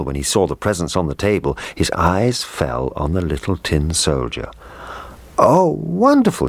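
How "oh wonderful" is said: put-on high, loud boyish delight; delighted cry. A narrator's reading. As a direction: cry out in thrilled wonder. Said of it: "he cried".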